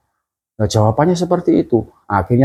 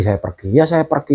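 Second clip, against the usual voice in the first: ordinary talk, nothing marked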